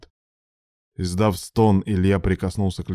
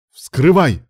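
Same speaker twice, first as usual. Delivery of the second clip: loud, high-pitched shout; yelled order; 8 semitones higher, 10 dB louder than usual. A narrator's reading. Ilya ordering rescuers to cut the car, yelling. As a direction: yell the command urgently. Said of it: "заорал Илья".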